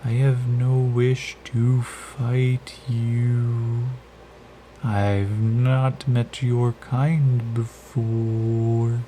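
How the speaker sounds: slow, soft otherworldly voice; soft gentle creature. Acted. Creature speaking into Yakim's head, slow and gentle. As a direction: speak slowly and gently into his mind. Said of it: "It's very soft and gentle".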